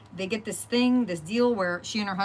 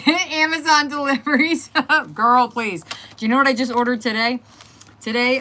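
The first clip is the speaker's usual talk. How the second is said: laughing excitedly; 6 semitones higher, 10 dB louder than usual. Real, not acted. Gushing about her Amazon orders.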